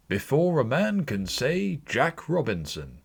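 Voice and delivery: put-on a jolly old master shouting orders to close up; Fezziwig crying out. A narrator's reading. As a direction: hail the lads with festive cheer. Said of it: "cried old Fezziwig".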